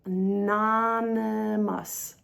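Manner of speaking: "anonymous" at half speed, holding each syllable; drawn-out anonymous. Shown as a demonstration. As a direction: stretch "anonymous" out very slowly. Stretching the word for learners.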